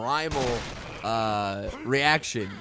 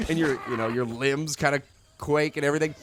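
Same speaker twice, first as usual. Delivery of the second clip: ordinary talk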